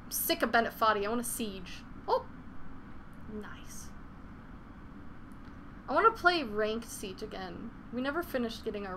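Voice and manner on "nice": whisper. Real, not acted. Quiet aside during gameplay.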